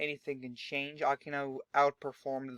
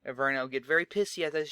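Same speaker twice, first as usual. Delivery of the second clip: ordinary talk, nothing marked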